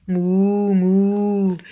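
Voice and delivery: long, drawn-out moos; cow moo. A narrator's reading. Reader shows how Mister Brown sounds like a cow, mooing. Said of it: "He can sound like a cow".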